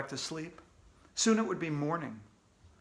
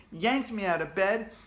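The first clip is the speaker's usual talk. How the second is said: plain reading voice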